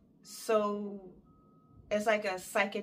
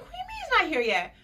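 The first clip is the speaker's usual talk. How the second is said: high, fast, disbelieving retort; indignant demand; 12 semitones higher, 4 dB louder, faster than usual. Retold or imitated. Wife in labor asking nurse about doctor, high indignant. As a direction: fire it back in outraged disbelief.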